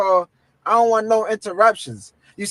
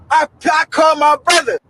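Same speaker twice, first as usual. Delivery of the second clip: normal talking voice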